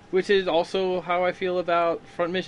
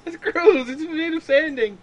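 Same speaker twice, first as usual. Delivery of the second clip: high-pitched disgust; 13 semitones higher, 4 dB louder, slower than usual. Real, not acted. Reacting to eating sponge.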